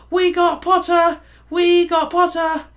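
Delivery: high, loud gleeful chant; twins yelling. A narrator's reading. Weasley twins cheer their new housemate, yelling. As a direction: yell it across the table. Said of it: "the Weasley twins yelled".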